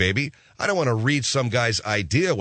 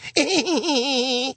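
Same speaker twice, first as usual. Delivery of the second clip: high cackle; 12 semitones higher than usual. Real, not acted. Own laugh.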